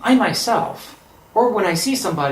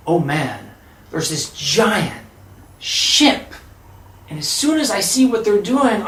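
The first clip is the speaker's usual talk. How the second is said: plain reading voice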